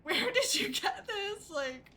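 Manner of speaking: high, loud gushing; excited fan squeal. Retold or imitated. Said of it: "she was like".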